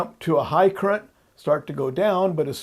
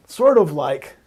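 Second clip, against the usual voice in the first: "sort of" hit louder and held, like a caveat; stress on sort of; 7 dB louder, slower than usual. Shown as a demonstration. Teacher stressing "sort of" to hedge a comparison. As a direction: lean hard on "sort of" to hedge. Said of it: "I do emphasize sort of".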